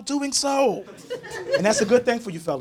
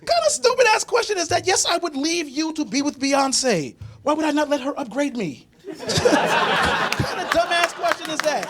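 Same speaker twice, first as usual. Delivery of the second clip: high-pitched, emphatic disbelief; acted-out exasperated squeal; incredulous retort; 11 semitones higher than usual. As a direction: answer as if it's the dumbest question ever. Acted retort to girlfriend's absurd question.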